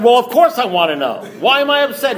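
high, insistent exasperation; acted-out indignant impatience; impatient demand.

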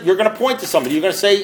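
ordinary talk

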